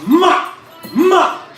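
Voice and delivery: sharp, high, loud shouts; yelling. Real, not acted. Fan yelling at the fighter on the TV, high and loud. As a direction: bark the word at the screen twice.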